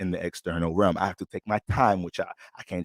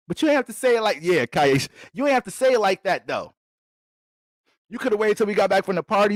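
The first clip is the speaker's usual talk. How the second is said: higher, hurt protest voice; offended girlfriend; 10 semitones higher than usual. Acted. Girlfriend protests to her boyfriend about the dress comment, hurt and higher. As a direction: pout like a girlfriend called out.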